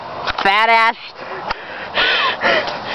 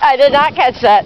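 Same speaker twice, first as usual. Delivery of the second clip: ordinary delivery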